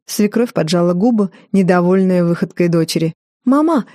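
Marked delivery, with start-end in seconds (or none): 3.5-3.9 put-on high chirpy girlish call; chirping. A narrator's reading. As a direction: chirp it out like a cartoon princess. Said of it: "прощебетала вдруг Джорджина".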